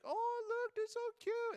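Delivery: high cutesy squeal; gushing over the squirrels, high and cutesy; mock cooing. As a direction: coo like a doting fan. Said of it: "people that are like".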